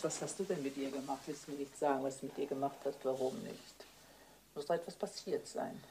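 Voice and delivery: quiet pressing. Acted. Wife quietly pressing her husband for the truth, hushed. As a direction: coax it out of him under her breath.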